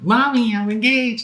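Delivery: high, squeaky mock of an excited young bride; giddy daughter voice. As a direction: play her as a giddy girl calling mommy. Imitating wife calling her mom.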